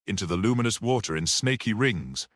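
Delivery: ordinary delivery, nothing marked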